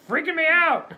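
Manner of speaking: alarmed shout. Acted. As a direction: blurt it out in alarm. Dummy complains about lips.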